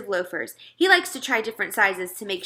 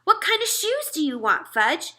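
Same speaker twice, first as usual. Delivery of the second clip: firm, higher mother voice; mother laying down the law, firm; meaning it; 11 semitones higher than usual. A narrator's reading. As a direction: say it like you mean it. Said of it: "my mother said like she meant it".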